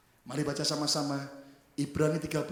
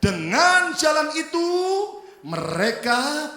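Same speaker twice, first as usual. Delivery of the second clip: loud, slow, stretched recitation; unison scripture reading; 10 semitones higher, 12 dB louder, much slower than usual. Real, not acted. Preacher reading the verse with the congregation, loud drawn-out recitation. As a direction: draw the words out for everyone to follow.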